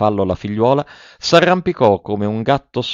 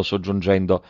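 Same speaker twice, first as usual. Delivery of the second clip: ordinary delivery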